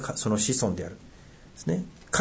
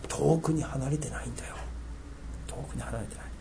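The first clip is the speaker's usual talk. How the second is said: soft whisper; 13 dB quieter than usual. Real, not acted. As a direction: whisper the reassurance, then let it fade. Preacher reassures softly, repeats. (ささやくように優しく)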